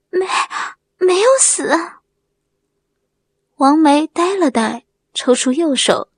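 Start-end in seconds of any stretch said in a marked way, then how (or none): 0.1-1.7 halting, high-pitched surprise; stunned disbelief. A narrator's reading. Wang Mei learns they are alive, stunned. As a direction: stammer it, pitched up, hardly believing it.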